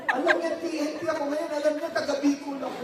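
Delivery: hammed-up frightened whine; nervous panic. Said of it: "Lalo siya ninervyos". (kunwaring kinakabahan, mataas ang boses)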